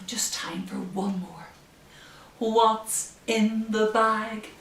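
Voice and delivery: ordinary delivery, nothing marked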